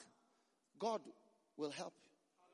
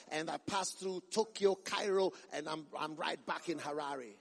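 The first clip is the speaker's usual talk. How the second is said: mock jet-setting preacher; 4 semitones lower than usual. Preacher reporting back to Harare on world tour, mock-pompous recital. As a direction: parody a preacher reeling off glorious travels. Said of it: "No, they say".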